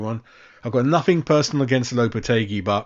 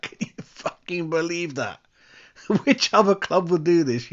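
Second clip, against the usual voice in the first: laughing disbelief; 7 semitones higher than usual. Real, not acted.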